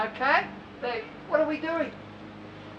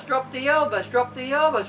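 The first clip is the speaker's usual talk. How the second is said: ordinary talk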